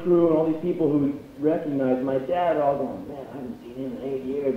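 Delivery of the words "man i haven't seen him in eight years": low, quiet onlooker's murmur; hushed aside. Retold or imitated. Friends spotting his dad, low hushed wonder.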